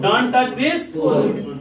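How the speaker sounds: slow model sentence. Shown as a demonstration. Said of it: "teacher models sentence".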